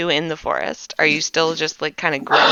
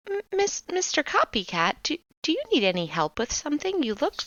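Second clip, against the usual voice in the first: timid high voice; 7 semitones higher, 5 dB quieter than usual. Acted. GM voices Bitsy to Copycat.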